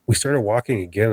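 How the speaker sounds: ordinary delivery, nothing marked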